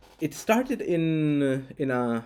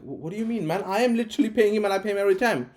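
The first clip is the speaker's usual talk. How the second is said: higher, faster, exasperated pleading; exasperated protest; 7 semitones higher, faster than usual. Retold or imitated. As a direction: rush it out in disbelief, pitched up. Defending himself in the argument.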